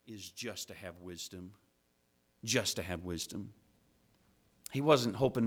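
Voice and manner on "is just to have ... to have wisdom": hushed tender wish. Retold or imitated.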